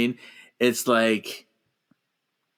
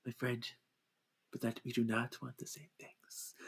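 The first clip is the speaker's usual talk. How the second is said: much softer, solemn refusal; hushed reply; 2 semitones lower, 18 dB quieter than usual. Retold or imitated. Xavier refusing Eric, hushed solemn reply. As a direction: let it fall quiet and final. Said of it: "he's like".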